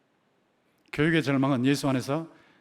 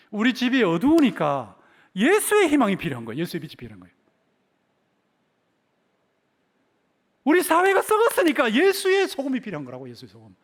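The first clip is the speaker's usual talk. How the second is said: raised, ringing pulpit appeal; full-force preaching; 7 semitones higher, 8 dB louder than usual. Acted. Preacher calling for Jesus' light and salt, impassioned preaching. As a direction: build to a high, loud cry.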